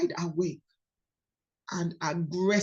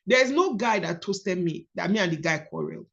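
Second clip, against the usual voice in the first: ordinary talk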